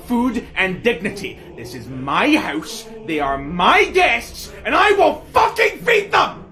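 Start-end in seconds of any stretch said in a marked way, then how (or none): 1.6-6.4 slow building into a high shouted vow; rising defiant roar. Acted. Monarch defending her guests, building to a roar. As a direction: build from firm to a fierce shout.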